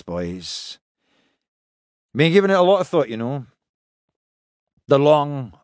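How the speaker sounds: hesitant, put-on weary resignation speech; mock-serious captain. Captain pranks his crew by pretending to quit, mock-serious. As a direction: play grave, with heavy pauses between complaints.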